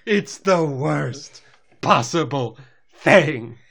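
slow, overly dramatic drawn-out declaration; Rarity. Retold or imitated. Drama queen reacting to her friend leaving, hammed-up anguish. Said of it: "you can't be overly dramatic".

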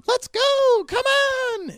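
very high, loud squeal; eager alien urging a ride; excited Stitch. Acted. Stitch bounces on Susanna's back, squealing high. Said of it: "and it's like".

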